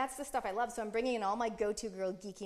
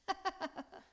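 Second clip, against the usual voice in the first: burst of laughter; 10 semitones higher, 4 dB quieter than usual. Real, not acted. Laughing at own dramatic pause.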